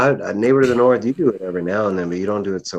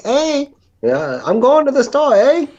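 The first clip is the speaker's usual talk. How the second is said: very high, loud cartoon Canadian; mock Canadian accent; 7 dB louder, slower than usual. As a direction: put on a squeaky, hammed-up Canadian "eh" voice. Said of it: "none of y'all do the A".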